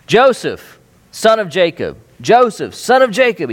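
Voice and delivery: high, loud call across the house. Retold or imitated. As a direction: call him as if he's in another room. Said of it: "he calls out".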